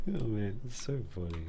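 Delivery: normal talking voice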